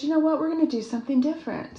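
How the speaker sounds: upbeat self-quote. Retold or imitated. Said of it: "and I said".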